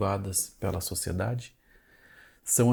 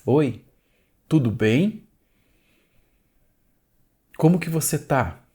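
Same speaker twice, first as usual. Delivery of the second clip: demo greeting; 3 semitones higher, 4 dB louder than usual. Shown as a demonstration. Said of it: "Se eu pergunto agora pra você".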